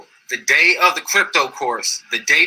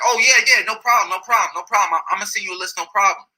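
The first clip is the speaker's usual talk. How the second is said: eager mock reassurance; 6 semitones higher, faster than usual.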